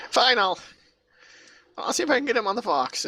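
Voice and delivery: high, hurried, exasperated surrender; flustered assistant giving in. Acted. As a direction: give in, high and rushed. Flustered assistant gives in to visitor.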